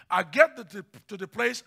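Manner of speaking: normal talking voice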